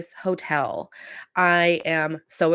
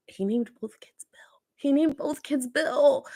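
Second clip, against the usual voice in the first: stunned whisper; 8 semitones higher than usual. Real, not acted. To viewers about the dad's secret family, a shocked whisper. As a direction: hush it like a scandal, higher and slower.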